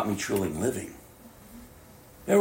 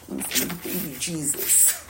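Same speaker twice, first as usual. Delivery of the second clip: slow scornful whisper; mocking whisper; 5 semitones higher, 9 dB quieter, much slower than usual. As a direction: dismiss it with a sneer. Mocking sentimental view.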